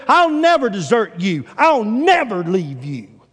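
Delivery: loud, high, insistent protest of loyalty; emphatic vow. Acted. Peter promises Jesus he'll never desert him, loudly insistent.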